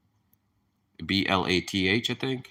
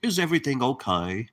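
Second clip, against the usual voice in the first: a light, higher British accent; 6 semitones higher than usual. Shown as a demonstration. Showing viewers a light British accent. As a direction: say it the polite British way. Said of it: "I put the little British accent in there".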